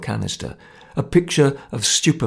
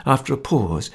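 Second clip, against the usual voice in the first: ordinary delivery, nothing marked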